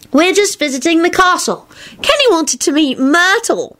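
put-on high, defensive child voice; protesting boy. A narrator's reading. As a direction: explain yourself in a high boy's voice. Boy protests to suspicious adult.

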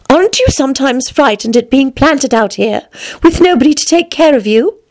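put-on high-pitched questioning girl; young Alice. Alice questioning the flowers, high girlish voice.

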